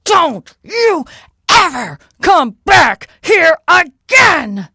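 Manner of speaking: high, very loud, slow giant's bellow; furious yell. Acted. Giant yells across the bridge at Molly, furious shout. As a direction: yell it word by word in rage.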